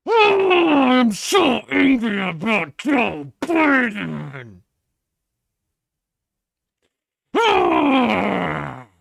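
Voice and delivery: slow, high, loud fake sobbing rant; mock angry conservative. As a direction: wail it like an angry caricature. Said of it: "That's your average conservative".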